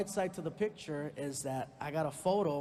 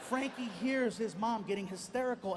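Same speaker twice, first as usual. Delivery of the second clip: normal talking voice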